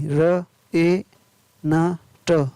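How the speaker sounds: slow, separate phonics sounds; word broken slowly into its sounds; sounding out. Shown as a demonstration. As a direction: say each sound on its own. Phonics teacher sounding out spelling.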